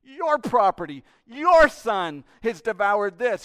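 loud, slow, accusing; elder son berating dad; indignant son. Acted. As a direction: hammer the blame home. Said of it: "Just feel that".

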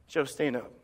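drops to a whisper by the last words; quiet, solemn voice of God; hushed whisper. Acted. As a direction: speak God's words softly, fading to breath. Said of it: "And God looks at you and says".

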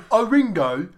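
a lightly voiced swooning British fan; mock British swoon. Mocking the Beatles' British accent, lightly swooning British. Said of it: "weird British slack-jawed faces".

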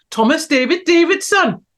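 high, loud mock Welsh lilt; bad Welsh accent. Host showing chat how Welsh the name is, bad Welsh accent. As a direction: do an unconvincing Welsh accent with gusto. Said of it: "I don't think that's a very good Welsh accent".

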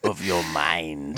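low, slow, menacing echo; low slow villain voice. Retold or imitated. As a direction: drag it out low and ominous. Echoing the villain line.

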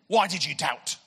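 loud, accusing question; acted stern telling-off; angry voice. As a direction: demand it like an angry God. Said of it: "Why do we always give God an angry voice?".

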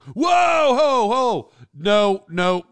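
high, loud mock-scandalized whoa; alarmed shout. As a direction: jump in to stop him, hammed up. Director objecting in the meeting.

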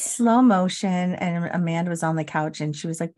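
usual speaking voice

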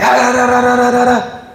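high, babbling mock tongues; hammed-up glossolalia babble; mock speaking in tongues. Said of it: "just start speaking in tongues".

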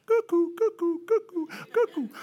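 high, piping cuckoo sound; high comic cuckoo noise; imitated cuckoo calls. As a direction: chirp like cartoon birds circling a dazed head. Comic birds after the slam.

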